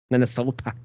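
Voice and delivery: "Minnesota" with a Midwestern twang; Midwestern accent. Pretend. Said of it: "The Midwestern accent".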